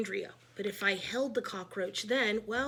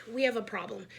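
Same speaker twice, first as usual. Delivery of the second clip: ordinary delivery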